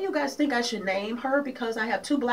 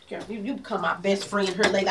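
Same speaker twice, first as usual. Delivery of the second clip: normal talking voice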